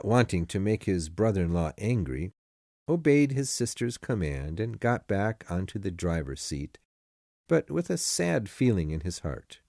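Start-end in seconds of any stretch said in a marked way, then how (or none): none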